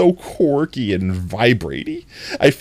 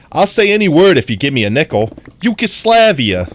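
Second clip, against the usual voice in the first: loud, fast, higher mock Joe Pesci hustle; Joe Pesci impression; 6 semitones higher, 6 dB louder than usual. Streamer doing Joe Pesci for chat, loud and fast. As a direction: bark out the deal like a wiseguy Joe Pesci. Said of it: "I could be like Joe Pesci".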